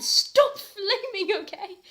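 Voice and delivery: high, loud, slow mock scolding; mock angry author. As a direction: ham up the author's rant. Reader parodies angry author note.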